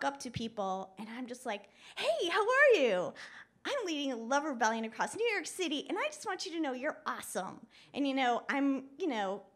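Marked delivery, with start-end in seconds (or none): 2.0-7.7 loud, high, pushy greeting; over-cheerful street pitch, starting big and high; cheery and happy. Retold or imitated. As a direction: push the joy on them, bright and forceful. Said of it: "cheery and happy, and I'm, like, pushing the joy on people".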